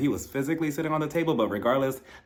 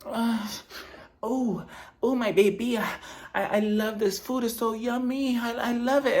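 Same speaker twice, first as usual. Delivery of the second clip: Dorit moaning, put-on accent; 9 semitones higher than usual. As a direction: moan over food in a put-on accent, high-pitched. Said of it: "she starts moaning in a different accent".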